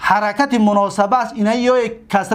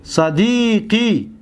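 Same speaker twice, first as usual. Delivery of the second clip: صدیقی drawn out very slowly, each syllable apart; slow demonstration; much slower than usual. Shown as a demonstration. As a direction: spell صدیقی out slowly for the class. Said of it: "دقت بتید". (صدیقی را خیلی آهسته و هجا به هجا بگو)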